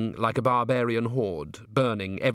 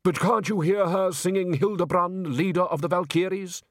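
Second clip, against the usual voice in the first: put-on higher-pitched, rapturous old opera lover; enthusing old musician; 7 semitones higher than usual. Undershaft urging Salzella to imagine her singing, rapturous. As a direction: plead dreamily for the music.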